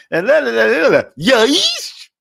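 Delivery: high, slow Swiss yes; mock Swiss accent. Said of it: "he becomes Swiss right at the end".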